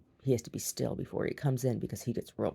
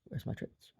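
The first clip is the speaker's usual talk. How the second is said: unvoiced, much softer plea; whispered dog voice; 7 semitones higher, 11 dB quieter than usual. Voicing the patient dog asking for treats, whispered. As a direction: breathe it like a patient begging dog. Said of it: "He's like".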